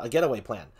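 ordinary talk, nothing marked

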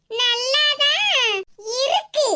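நல்லாதான் stretched very slowly, grudging; drawn-out reluctant praise. Acted. Mother-in-law grudgingly praising the dress, dragging நல்லாதான். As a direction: drag out நல்லாதான் as if unwilling to praise. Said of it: "நல்லாதான் இருக்குன்னு நல்ல இழுக்காவோ".

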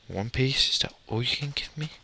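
normal talking voice

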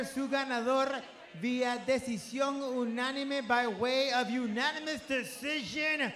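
ordinary talk, nothing marked

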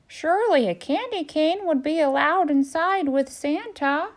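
high, bright realisation; excited turkey voice. Acted. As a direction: seize the new idea. Turkey thinking.

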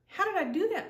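high amazed question; girl amazed by the bird; surprised. Acted. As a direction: gasp it out, amazed. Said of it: "To her surprise".

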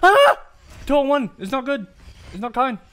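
very high, loud shriek then yelling; frightened scream. Real, not acted. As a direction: scream at the scare. Scared yelling.

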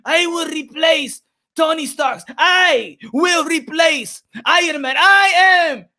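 hyped-up, soaring bragging; triumphant boast. Real, not acted. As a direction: crow it to the crowd, word by word. Triumphant boasting for the Mean Machine.

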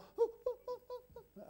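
high whiny dog whimper, badly faked; poor imitation of a whining stray dog; imitated dog whimper. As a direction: squeak like a hurt dog, hammed-up. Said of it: "I don't do dog sounds very well".

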